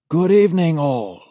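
put-on high, loud genteel old man voice; senior auditor announcing. A narrator's reading. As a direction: announce it like a courteous elderly official. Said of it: "announces the senior auditor".

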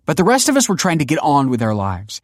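high, loud, exasperated scolding; scornful tirade. A narrator's reading. Daughter scornfully scolds her father.

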